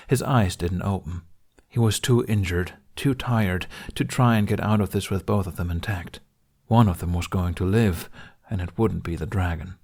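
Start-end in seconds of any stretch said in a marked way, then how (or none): none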